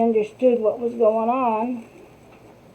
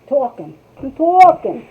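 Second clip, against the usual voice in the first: Jersey accent; 4 semitones higher, 3 dB louder than usual. Real, not acted. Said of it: "there it came, the Jersey accent out".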